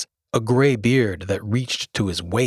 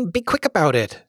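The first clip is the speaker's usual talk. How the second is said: higher, pushy hurry-up; impatient urging; 8 semitones higher than usual. A narrator's reading. Spelling bee hurrying Milo to choose the menu, impatient. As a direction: press him to decide quickly. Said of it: "suggested the spelling bee".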